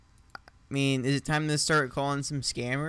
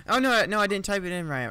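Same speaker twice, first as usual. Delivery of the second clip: alarmed exclamation; 9 semitones higher, 7 dB louder, faster than usual. Real, not acted. Frustrated mistake exclamation.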